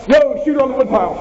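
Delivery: high, fast shouted warning; urgent callout. Real, not acted. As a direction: yell out the shooter's position. Callout during firefight.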